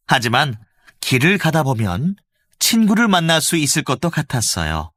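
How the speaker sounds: ordinary delivery, nothing marked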